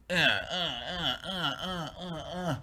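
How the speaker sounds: quiet, short repeated grunts; imitated jab noises. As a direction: act out rapid little jabs, softly. Imitates twenty shots.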